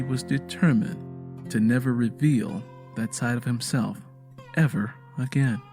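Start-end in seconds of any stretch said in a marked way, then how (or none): none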